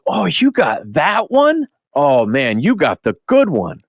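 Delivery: high, drawn-out impressed reaction; imitated admiring handler. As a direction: gush over someone's lucky find, retold. Imitating the handler admiring his sketch.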